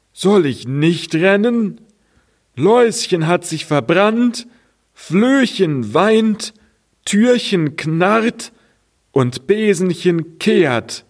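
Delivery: high, sing-song lament; lamenting cart. Acted. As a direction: recite the woes as a rattled cart. Cart lists the woes.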